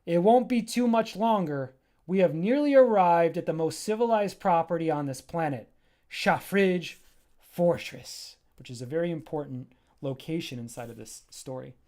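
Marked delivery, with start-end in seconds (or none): 0.0-8.4 Charda proud. Acted. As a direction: show off the fortress grandly. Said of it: "He goes".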